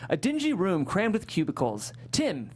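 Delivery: normal talking voice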